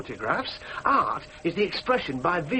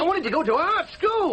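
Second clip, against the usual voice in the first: high, wounded outburst; aggrieved protest; 10 semitones higher than usual. Acted. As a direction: argue back at your parents, pitch raised. Son quarrels with his parents.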